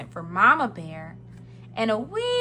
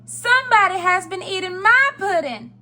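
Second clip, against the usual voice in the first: middle-sized bear voice, loud and high; Mama Bear; 9 semitones higher, 8 dB louder than usual. A narrator's reading. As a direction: exclaim loudly as the mother bear. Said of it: "in her middle-sized voice".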